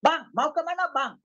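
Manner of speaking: high, forceful Batak-style question; Batak manner. Shown as a demonstration. Preacher imitating a Batak calling a man, forceful Batak manner. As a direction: sound angry without being angry, Batak style. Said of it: "jangan pikir dia marah-marah".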